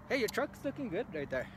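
ordinary talk

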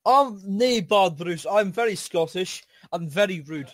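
mock Scottish. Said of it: "extreme Scottish for polite Scottish".

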